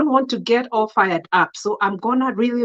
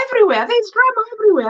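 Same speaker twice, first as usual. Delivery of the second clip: exasperated outburst; 10 semitones higher, much faster than usual. Real, not acted. Exasperated rant about church drama.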